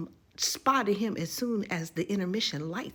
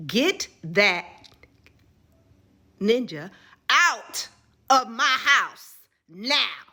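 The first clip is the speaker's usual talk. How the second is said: loud, slow, higher furious order; grandma's angry order; 6 semitones higher, 9 dB louder, much slower than usual. Retold or imitated. Grandma throwing the boyfriend out, loud and furious. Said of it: "She said it again".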